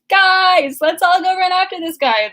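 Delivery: high, loud, fast call to the group; rally the crowd in a hurry; mock rallying call. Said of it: "He's like".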